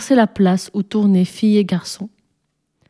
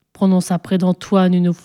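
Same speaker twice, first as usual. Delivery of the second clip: plain reading voice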